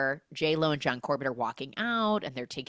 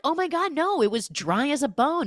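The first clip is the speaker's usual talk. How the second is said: high, loud enthusiasm; mock breezy denial; 9 semitones higher, 5 dB louder than usual. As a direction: contradict with overdone cheer. Retold campers cheerfully contradicting her.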